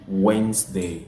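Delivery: Wednesday as wensday, middle letters silent; correct pronunciation. Shown as a demonstration. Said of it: "On ne prononce pas les lettres du milieu".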